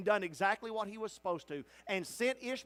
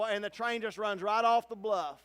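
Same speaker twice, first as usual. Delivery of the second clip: ordinary delivery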